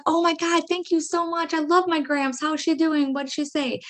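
high, bubbly excited thanks; excited gushing. Retold or imitated. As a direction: gush like a thrilled granddaughter. Excited reply to psychic medium.

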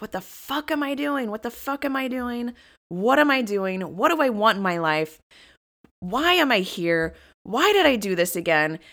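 high, exasperated retold rant; angry self-questioning. Woman retells pacing the hotel room, angrily questioning herself. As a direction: berate yourself, punching each question word. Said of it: "and I was angry".

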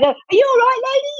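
high-pitched imitated call from a stranger; concerned passerby. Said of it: "this person's like".

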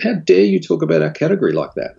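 mock outrage, voice pushed up high; furious client. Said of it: "she was furious".

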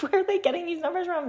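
high, laughing disbelief; incredulous. Real, not acted. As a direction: ask it in amused disbelief. Repeats the question, incredulous.

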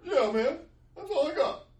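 high, loud mock-tearful reply; squeaky acted crying voice; sniffling. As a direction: answer through hammed-up tears. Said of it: "and I sniffle".

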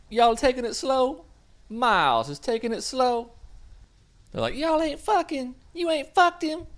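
very high, shrieky gossip voices; teasing girlfriends. Retold or imitated. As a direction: squeal it like nosy girlfriends.

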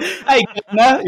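high, laughing mock English accent. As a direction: call it out in a playful English accent. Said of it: "in the English accent".

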